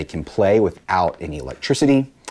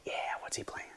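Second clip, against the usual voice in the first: whisper; 19 dB quieter, much faster than usual. Real, not acted. Teacher whispers a question.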